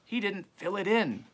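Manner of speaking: a hint of a grumbling old dog. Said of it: "said the old dog".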